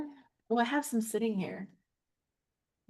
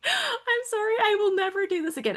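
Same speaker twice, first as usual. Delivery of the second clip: pleading apology; 11 semitones higher than usual. Retold or imitated. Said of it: "It was".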